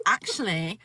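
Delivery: mimicking a British-sounding "actually"; mimicked British accent. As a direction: echo "actually" back in a teasing British accent. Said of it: "Did you just say 'actually'".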